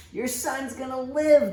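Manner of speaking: light up with relief; joyful thought. Acted. Father thinking of Jesus' promise, joyful.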